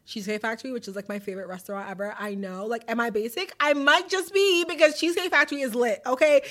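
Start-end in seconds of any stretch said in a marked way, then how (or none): 3.6-6.4 higher, louder hype; excited gushing. Real, not acted. Telling listeners about Cheesecake Factory, excited and loud. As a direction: gush like it's the best place ever. Hyping her favourite restaurant.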